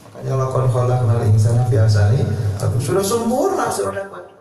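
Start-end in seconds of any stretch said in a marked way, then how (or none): 0.4-2.8 melantunkan ayat dengan nada tilawah; Quran recitation. Acted. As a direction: baca ayat seperti mengaji, lebih rendah. Quoting a Quran verse in a sermon. (lantunan ayat Al-Quran)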